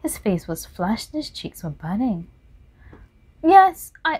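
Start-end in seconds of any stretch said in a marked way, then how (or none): 3.4-4.2 outburst. Acted. Dorian bursts out.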